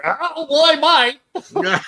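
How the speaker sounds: high, loud mimicking squeal; mock defensive answer. Teasing a friend over his answer, high mocking imitation. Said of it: "It was like your, your answer".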